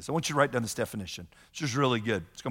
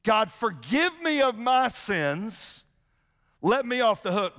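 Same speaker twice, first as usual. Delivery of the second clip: slow, higher, pleading prayer voice; mock pleading prayer; 8 semitones higher, 3 dB louder, slower than usual. Pastor voicing a churchgoer asking God for mercy, mock pleading. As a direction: draw out the plea to God.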